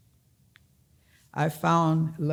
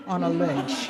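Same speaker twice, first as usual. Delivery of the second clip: ordinary talk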